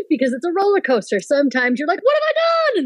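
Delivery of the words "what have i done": high, squeaky mock panic; high panicked what have I done. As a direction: shriek it in comic dread. Panicked moment of doubt.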